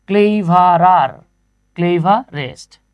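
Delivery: "Cleverer, cleverest" very slow, each syllable drawn out; "cleverer, cleverest" stretched to show the added endings. Shown as a demonstration. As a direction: spell out "cleverer, cleverest" by sound for a class. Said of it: "clever কয়বার উচ্চারণ হয়েছে".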